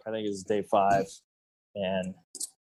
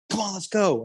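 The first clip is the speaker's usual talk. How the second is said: urgent call; 10 semitones higher than usual. Retold or imitated. Buddy urges hunt to bull.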